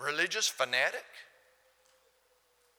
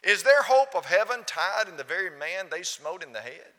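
ordinary delivery

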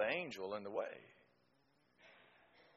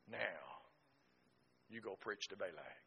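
quiet voice of God; 4 semitones lower, 10 dB quieter than usual. Retold or imitated. God's word to Balaam.

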